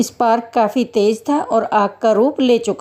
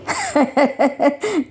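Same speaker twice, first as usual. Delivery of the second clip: short, higher-pitched staged laugh; mocking chuckle; 4 semitones higher than usual. Guard laughs off the halwa offered as a bribe, amused. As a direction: chuckle it off dismissively.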